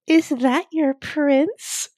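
slow, high teasing princess voice; sly princess tease. Retold or imitated. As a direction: drawl it out like a knowing princess.

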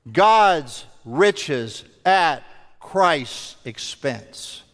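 ordinary talk, nothing marked

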